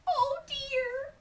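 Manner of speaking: high, mournful mama monkey; very sad. Acted. Mama monkey missing her baby, high dramatic sorrow. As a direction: lament as a worried monkey mother. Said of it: "very sad".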